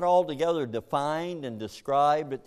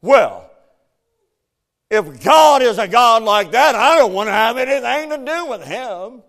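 higher, much louder defiant outburst; defiant rejection; 8 semitones higher, 14 dB louder than usual. Retold or imitated. People rejecting God, loud high defiant outburst. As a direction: throw God off in a huff. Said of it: "many people who say".

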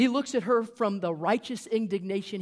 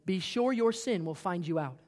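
ordinary talk, nothing marked

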